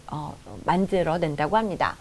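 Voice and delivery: normal talking voice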